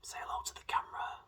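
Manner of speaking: slow breathy whisper to the drink; seductive whisper. Pretend. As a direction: whisper to it like a lover.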